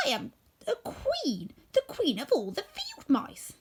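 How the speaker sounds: high, slow and haughty; proud mouse queen. A narrator's reading. Mouse queen correcting the woodman, high and proud. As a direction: draw yourself up, small but royal.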